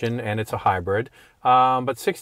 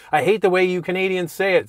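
mocking annoyed Americans; 7 semitones higher, 3 dB louder than usual. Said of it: "the Americans go".

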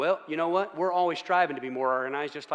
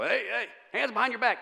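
higher barked police command; law enforcement voice; 7 semitones higher than usual. Retold or imitated. Officer ordering a kid, hands behind back. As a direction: snap orders like a cop arresting someone. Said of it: "my instructor academy mode".

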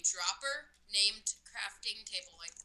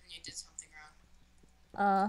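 whisper; 4 semitones lower than usual. Real, not acted. Whispered tease.

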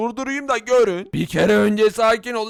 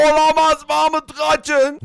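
alarmed call to run, a bit louder; panicked cry; 3 semitones higher, 4 dB louder than usual. Acted. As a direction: shout a warning in fright. Villager panics at the monster.

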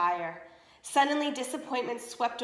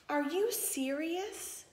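higher, softer and very slow, dripping disdain; scornful disbelief; 6 semitones higher, 5 dB quieter, much slower than usual. Acted. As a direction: draw it out in mock disbelief. Stepmother scorns Adelita.

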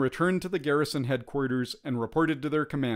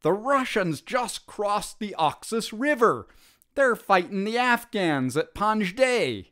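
higher, breathless urgent report; out of breath; 8 semitones higher than usual. A narrator's reading. Soldier to his comrades, breathless with alarming news. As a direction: soldier gasping out bad news after running. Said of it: "ran up to them out of breath".

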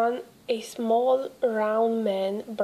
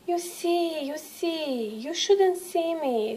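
a higher, hushed hint of Santa; lightly voiced Santa; 6 semitones higher, 3 dB quieter than usual. A narrator's reading. Santa hushing the boy who spotted him, a touch higher. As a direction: Santa leaning in to share a secret, softly.

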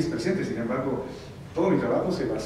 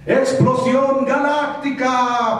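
much higher, loud, very slow battle cry; villain attack shout; 12 semitones higher, 6 dB louder, much slower than usual. Retold or imitated. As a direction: bellow the attack name slowly. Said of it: "malo, duro y risa malvada".

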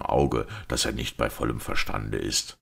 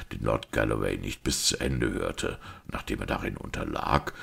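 usual speaking voice

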